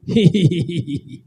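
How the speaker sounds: acted string of hee-hees; mock laughter. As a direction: giggle as the maid in the tale. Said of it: "तो हंसने लगी".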